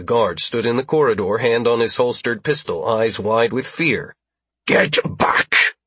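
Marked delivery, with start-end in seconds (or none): 4.7-5.8 hoarse, rasping alien yell; rough-voiced warning from an alien captor; alien's yell. A narrator's reading. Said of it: "Gregor yelled".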